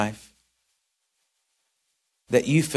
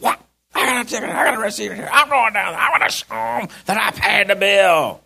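higher, mock-indignant outburst; fired up; 9 semitones higher, 4 dB louder than usual. Angry customer vowing to go down, fired up. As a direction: play the fired-up customer, building louder. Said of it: "I think you got fired up and said".

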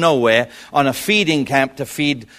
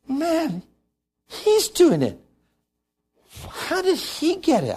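amazed; 12 semitones higher, 5 dB quieter, slower than usual. Retold or imitated. Father amazed at son.

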